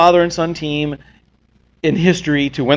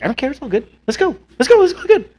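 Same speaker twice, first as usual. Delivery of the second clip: higher-pitched chirpy quote of her; breezy imitated enthusiasm; 9 semitones higher than usual. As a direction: lightly mimic her easygoing eagerness, pitch up. Retelling her easygoing replies.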